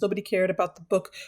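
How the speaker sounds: normal talking voice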